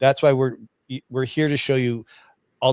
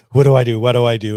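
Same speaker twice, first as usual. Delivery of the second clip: loud retold panic, each question blurted out; panicked; 10 dB louder, faster than usual. Telling listeners how he panicked, loud and frantic. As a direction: re-enact the frantic moment of not knowing. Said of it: "I'm like".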